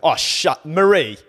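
loud, high outburst of disbelief; shocked loud protest; outraged cry. Real, not acted. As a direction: cry out her name in outrage. Outraged at friend's burger opinion.

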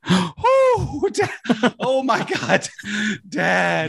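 imitating an awestruck kid, very high-pitched; overjoyed amazement. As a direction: gasp out astonished relief as the child, hammed-up. Retold child seeing world restored.

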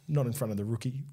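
low, discreet undertone; imitated hushed aside. Other referee cautioning his colleague, imitated hushed aside. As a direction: drop the volume as if keeping it from someone nearby.

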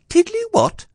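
very high, squeaky interruption; puzzled Piglet voice. A narrator's reading. Piglet queries the odd word, squeaking. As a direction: pipe up in a tiny voice.

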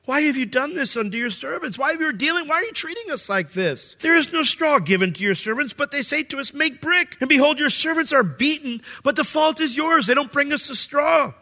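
high, strained crying-out plea; pleading officers. Acted. Israel's officers crying out to Pharaoh, high desperate plea.

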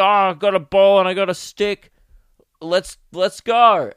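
loud, mock-thrilled kid with a simple toy; excited kid. Wartime kid delighted with ball and stick, mock excited. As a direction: blurt it out loudly with play-acted glee.

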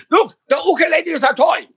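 very high, exasperated mock Chinese shopkeeper; Chinese accent. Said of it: "if you do an accent of a foreign person".

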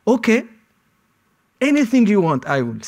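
frantic surrender to the interrogator; desperate pleading. Retold or imitated. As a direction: beg him, say anything to stop it. Pleading with interrogator under threat.

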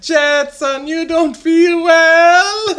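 high, slow mock South American mother; laughing South American mom accent; thick South American accent. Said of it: "had a really thick accent".

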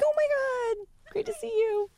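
high squealing hello; excited greeting. Retold or imitated. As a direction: gush at an old friend. Acted supermarket greeting.

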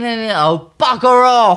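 loud, emphatic dismissal; Bridget. Retold or imitated. Columnist rejecting a returning suitor's flattery, loud mock dismissal. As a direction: send him packing at full volume. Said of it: "as Bridget would say".

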